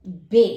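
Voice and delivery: बे, open e; German B as be. Shown as a demonstration. Teacher saying बे slowly to Hindi students. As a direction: say बे, the German name for B. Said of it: "बी को".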